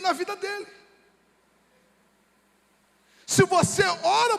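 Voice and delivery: high, insistent pulpit delivery; forceful preaching. Real, not acted. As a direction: drive each phrase out to the congregation.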